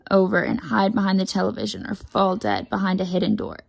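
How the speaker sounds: ordinary delivery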